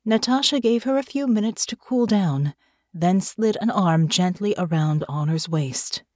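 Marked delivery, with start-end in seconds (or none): none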